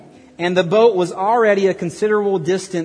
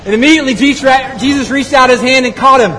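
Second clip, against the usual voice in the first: loud, raised storytelling over a storm; dramatic scripture narration; 7 semitones higher, 5 dB louder than usual. A narrator's reading. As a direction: project the Bible story high and loud.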